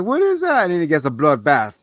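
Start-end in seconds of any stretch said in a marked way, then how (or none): 0.0-0.6 very high-pitched mimicked question; mock alarmed question. Retelling David hearing a noise in the shower, mock alarm. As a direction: squeal the question as the startled character.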